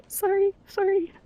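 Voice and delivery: high-pitched, teary sorry; tearful apology. Real, not acted. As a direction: apologise while trying not to cry. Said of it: "I don't know why I'm crying".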